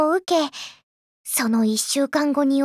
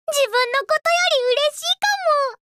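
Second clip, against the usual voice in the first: giddy; 9 semitones higher, faster than usual. Acted. Happy for her friend.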